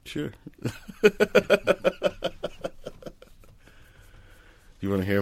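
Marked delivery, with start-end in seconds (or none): 0.4-3.1 long high, airy laugh; long chuckle. Real, not acted. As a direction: laugh on and on in quick breathy pulses. Amused after being asked for story.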